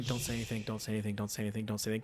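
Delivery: very low, hushed repeated mantra; barely audible, rapid inner muttering; muttered self-restraint. Retold or imitated. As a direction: chant it under your breath to hold yourself back. Retold silent self-restraint while standing there.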